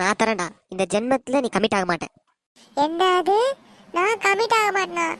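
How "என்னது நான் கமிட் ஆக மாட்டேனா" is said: high, disbelieving protest; dismayed outcry. Acted. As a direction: pitch shoots up in cartoon dismay. Reacting to a curse of staying single. (அதிர்ச்சியில் உயர்ந்த குரல்)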